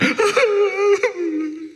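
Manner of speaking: mock bawling over the phone; hammed-up sobbing; imitated crying. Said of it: "and she's crying".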